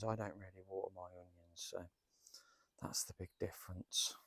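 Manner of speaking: soft whisper to camera; whispered aside. Real, not acted. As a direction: drop to a whisper. Aside about watering.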